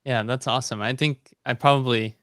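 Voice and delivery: usual speaking voice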